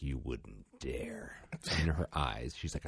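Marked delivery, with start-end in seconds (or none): 0.0-1.4 slow, low, unvoiced taunt; daring the parent in a hush; breathy slow threat of a child; defiant whisper.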